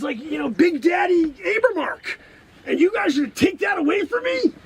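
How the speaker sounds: the wronged genius demanding credit; indignant rant. Acted.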